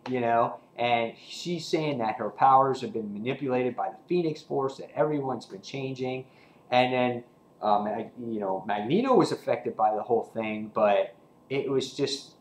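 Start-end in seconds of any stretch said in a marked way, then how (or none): none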